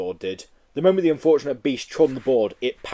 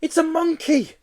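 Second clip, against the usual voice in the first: high startled cry; cried out. Acted. Hamburger seller crying out as the Yeti skids past, high startled cry. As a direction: yelp it out in alarm. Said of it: "cried a hamburger seller".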